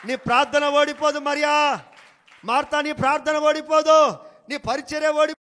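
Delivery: loud preaching, last name held high; fervent preaching. Acted. Pastor assuring Mary and Martha, loud fervent preaching. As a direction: proclaim it to Mary and Martha with fire.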